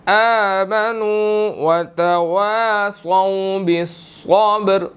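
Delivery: very slow melodic tajweed recitation; Quran recitation. Shown as a demonstration. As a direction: draw each word out in chant. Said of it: "recites the verse, teacher". (धीमी सुरीली तिलावत)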